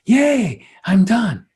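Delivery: high, loud joyful yay; gleeful cheer. Retold or imitated. The departed at their own funeral, gleeful high cheer. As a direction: cheer like a spirit set free. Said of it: "They're always going".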